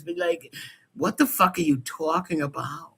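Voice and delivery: ordinary talk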